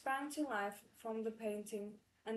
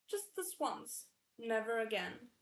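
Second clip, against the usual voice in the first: plain reading voice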